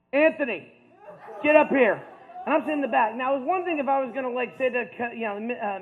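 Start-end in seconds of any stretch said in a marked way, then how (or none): 0.1-2.0 loud, higher coach's summons; calling out. Retold or imitated. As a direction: order a student up to the front. Coach calls him to front.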